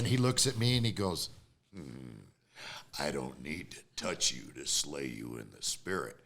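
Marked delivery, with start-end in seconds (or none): 1.7-6.2 low, unhurried preacher's retort; low, soft "hmm", then a measured reply; imitating the preacher. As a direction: answer a threat in a deep, unruffled voice. Voicing the preacher's calm reply.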